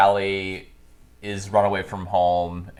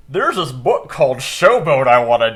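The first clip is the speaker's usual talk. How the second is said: louder and higher, acting out a film character; excited mock pitch; 4 semitones higher, 9 dB louder than usual. Retelling the composer's idea for a show, hammed-up excitement. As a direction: blurt out the big idea eagerly.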